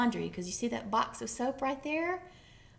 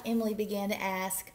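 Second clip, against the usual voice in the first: usual speaking voice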